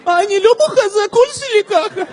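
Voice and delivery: very high, weepy Russian mother; mock sobbing Russian mom explaining herself; whiny crying voice. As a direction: protest in a tearful high-pitched wail. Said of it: "אמא, למה את בוכה".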